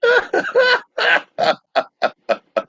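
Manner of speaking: high burst of laughter; big laugh. Real, not acted. Cohost laughs.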